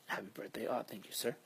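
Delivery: normal talking voice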